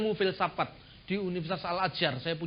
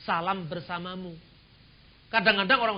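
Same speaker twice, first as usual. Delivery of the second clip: ordinary talk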